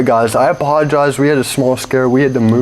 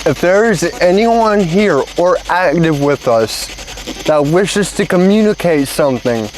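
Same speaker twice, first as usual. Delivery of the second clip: talking to a ghost; 6 semitones higher than usual. Real, not acted. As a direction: gently ask a spirit to answer. S0 calling out to spirits.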